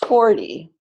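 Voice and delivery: "forty" with FOR punched; stress on first syllable. Shown as a demonstration. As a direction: model "forty" as FOR-ty. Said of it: "the correct clapping is".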